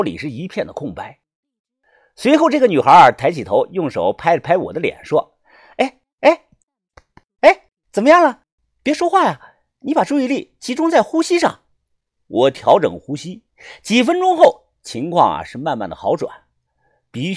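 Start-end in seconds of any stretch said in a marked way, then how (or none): 5.8-11.6 high-pitched female doctor voice; young woman doctor. A narrator's reading. Young doctor patting the patient's face, high attentive voice.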